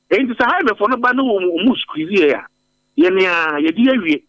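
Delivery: ordinary talk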